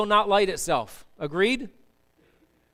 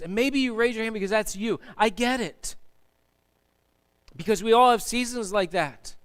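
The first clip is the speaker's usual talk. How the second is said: normal talking voice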